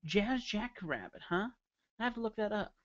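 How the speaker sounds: normal talking voice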